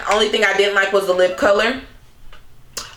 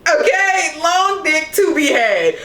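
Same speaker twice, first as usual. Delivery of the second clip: laughing; 6 semitones higher, slower than usual. Retold or imitated. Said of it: "he proceeds to say".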